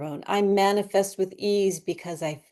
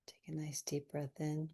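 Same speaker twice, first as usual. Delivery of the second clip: whisper; 15 dB quieter than usual. Shown as a demonstration. Breathing cue in meditation.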